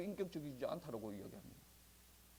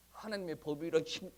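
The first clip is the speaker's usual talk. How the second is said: usual speaking voice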